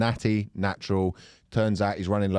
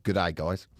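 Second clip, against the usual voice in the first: put-on low Australian accent greeting; mock Aussie accent, lower voice; attempted Australian accent; 6 semitones lower, 3 dB quieter than usual. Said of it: "No, go for Australian".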